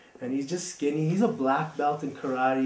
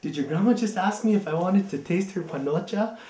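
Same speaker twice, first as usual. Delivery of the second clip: higher and louder, disbelieving question; whisper-shocked friend asks the brothers; incredulous guest; 6 semitones higher, 5 dB louder than usual. Retold or imitated. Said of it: "He's like".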